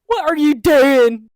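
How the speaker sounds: loud, high, drawn-out scolding; exasperated playful shout; mock outraged yell. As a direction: holler it slowly at a clueless friend.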